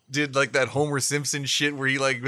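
ordinary delivery

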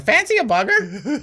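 high parrot voice with a laugh; British parrot voice. Retold or imitated. Co-host voices park parrots heckling, high and laughing. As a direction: chirp it like a cheeky parrot. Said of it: "Parrots in the background going".

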